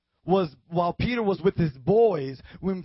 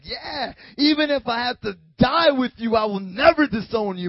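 loud, raised, defiant vow; bold; 5 semitones higher, 8 dB louder than usual. Acted. Peter vowing to Jesus among believers, bold and loud. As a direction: declare it at full confidence. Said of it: "he was bold. He was like".

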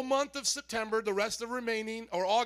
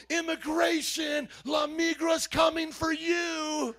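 higher, slow mock shout; mock yelling; 6 semitones higher, slower than usual. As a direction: joke scare yelled at the crowd. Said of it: "just yelling at you".

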